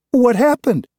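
put-on very high, loud child's cry; a little girl crying out in alarm; cried out. A narrator's reading. Young daughter dashing in to the mess, high loud cry. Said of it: "she cried".

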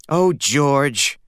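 a bashful, lightly voiced mother; blushing mother. A narrator's reading. Mother flattered by her husband's costume, blushing coo. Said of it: "blushed Stanley's mother".

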